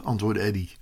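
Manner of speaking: ordinary delivery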